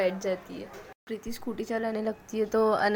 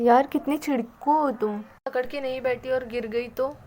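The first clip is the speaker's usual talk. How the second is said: loud, higher teasing retort; 3 semitones higher, 7 dB louder than usual. Acted. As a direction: needle her playfully. Teasing friend answers back.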